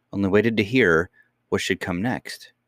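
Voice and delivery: ordinary delivery, nothing marked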